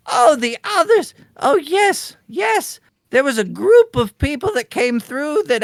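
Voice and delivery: high-pitched grandma suddenly remembering; chatty old woman. Acted. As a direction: jump high on the realisation, then tell the tale.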